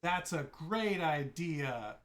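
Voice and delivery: slow sarcastic agreement. Acted. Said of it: "And Hepzibah's like".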